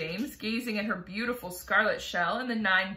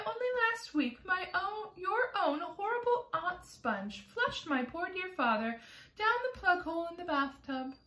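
high, hurt complaint; wronged spider; 10 semitones higher than usual. Acted. Miss Spider laments to James, high and hurt. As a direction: plead your innocence, high and hurt.

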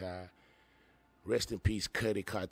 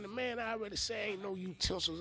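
ordinary delivery

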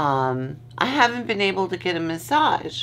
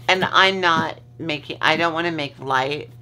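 usual speaking voice

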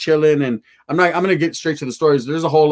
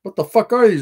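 raised, louder demanding call; confronting the cook, raised voice; 5 semitones higher, 4 dB louder than usual. Retold or imitated. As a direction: call out to the taco lady, higher and louder. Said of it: "And I told her".

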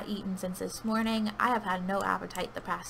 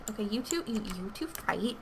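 ordinary talk

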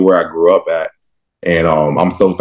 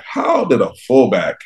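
higher-pitched, mock-baffled question; 6 semitones higher, slower than usual. Linebacker questioning his old fullback touchdowns, baffled. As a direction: puzzle over it in disbelief. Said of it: "I still get confused about this".

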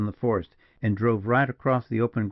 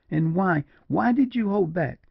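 high, impassioned woman's voice; distraught, high-pitched questioning; exclaimed reproach; 9 semitones higher than usual. A narrator's reading. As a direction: demand it, pitch climbing high. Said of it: "exclaimed Julia".

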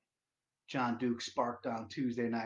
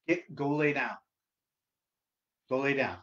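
stern command; 7 dB louder than usual. Real, not acted. Orders his dog away.

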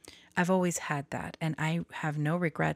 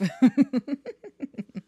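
louder burst of awkward laughter; nervous laughing burst; loud embarrassed laugh; 8 semitones higher, 9 dB louder than usual. Real, not acted. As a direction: laugh hard at your own mess. Laughing off crisis.

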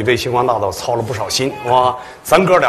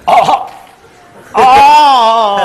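long high loud drawn-out ah; drawn-out realization; 12 semitones higher, 10 dB louder, much slower than usual. Real, not acted. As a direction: stretch out the dawning ah.

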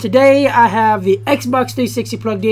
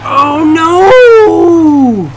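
loud high cry of dismay; sudden high oh no; 7 semitones higher, 8 dB louder than usual. Real, not acted. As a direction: cry out as it goes wrong. Crashes in race.

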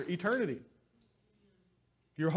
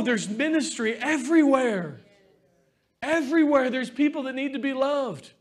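rising, louder preaching push; 7 semitones higher, 7 dB louder than usual. Acted. Pastor urging the church to live for others, full preaching force.

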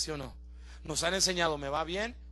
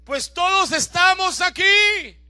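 slow, louder urgent plea; Pablo pleading; 8 semitones higher, 13 dB louder, slower than usual. Acted. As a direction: hold the jailer back slowly. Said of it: "Le dice". (Pablo suplica despacio al carcelero)

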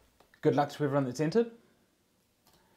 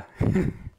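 breathy laugh; 4 dB louder than usual. Real, not acted. Laughing at own joke.

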